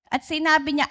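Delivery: normal talking voice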